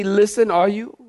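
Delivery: ordinary talk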